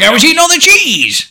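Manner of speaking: very high, loud goofy holler; mock yelling. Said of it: "He was like".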